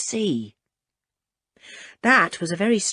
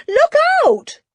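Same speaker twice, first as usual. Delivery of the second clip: very high, louder second warning yell; desperate warning yell; 8 dB louder than usual. Acted. Julian screams the warning again as the fist is raised. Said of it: "yelled Julian".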